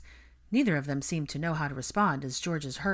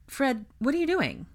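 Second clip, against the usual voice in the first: raised, worried question; concerned; 9 semitones higher, 4 dB louder than usual. A narrator's reading. Hermione questions Fred as he passes silently, concerned. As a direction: ask in higher pitch, with mild alarm. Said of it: "Hermione asked with concern".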